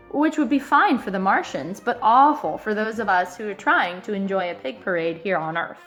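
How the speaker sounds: ordinary delivery, nothing marked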